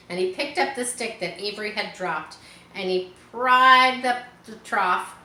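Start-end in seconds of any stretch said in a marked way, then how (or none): none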